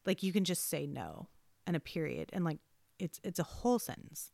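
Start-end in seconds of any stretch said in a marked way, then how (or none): none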